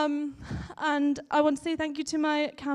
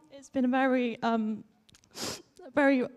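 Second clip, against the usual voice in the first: emotional. Real, not acted. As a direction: hold it together through the emotion. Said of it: "I'm a bit emotional right now".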